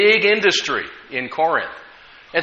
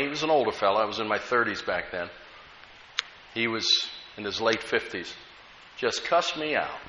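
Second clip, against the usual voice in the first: ordinary delivery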